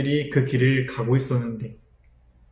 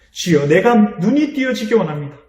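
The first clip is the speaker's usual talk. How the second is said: earnest plea; 6 semitones higher, 5 dB louder than usual. Acted. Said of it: "이렇게 고백해요".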